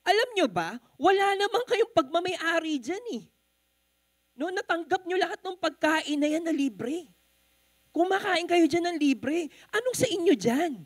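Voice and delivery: mock indignant waiter; irate waiter. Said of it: "Yun ang sabi ng waiter".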